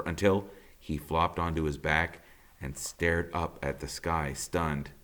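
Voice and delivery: ordinary delivery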